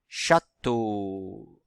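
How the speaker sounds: teacher's lengthened CHÂTEAU; sounding out. Shown as a demonstration. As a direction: stretch CHÂTEAU with a clear opening ch. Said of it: "Si vous entendez le son".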